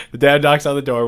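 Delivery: usual speaking voice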